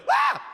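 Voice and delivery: short startled yelp "Ah!"; a scared shopper yelps; mock scream. Shopper startled by the returning ham, mock yelp.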